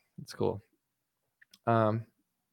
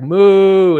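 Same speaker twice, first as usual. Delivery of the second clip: a loud, higher mock cow moo at his fans; 5 semitones higher, 9 dB louder than usual. Streamer mocking Trump fans as cattle, loud mock moo. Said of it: "you guys are like".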